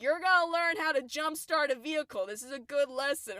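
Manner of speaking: high, loud retold parental scolding; yelling mom. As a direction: imitate a mother yelling out a lesson. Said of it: "My mom's yelling at me".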